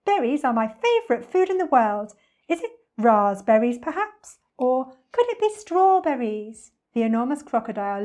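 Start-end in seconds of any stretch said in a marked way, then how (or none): 0.1-6.7 high, singsong guessing; lilting, louder bird voice; excited bird. Acted. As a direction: chirp each guess hopefully.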